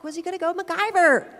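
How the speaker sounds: MacGyver sung out high and loud; sing-song dog call. Retold or imitated. Telling siblings how to call the dog, high sing-song. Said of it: "I'm like".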